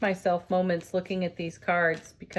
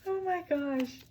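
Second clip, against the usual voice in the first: higher, softer, hushed wonder; awed surprise; 8 semitones higher, 5 dB quieter than usual. Real, not acted. Reacting to a card that shocks her, softly amazed. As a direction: breathe it out, amazed.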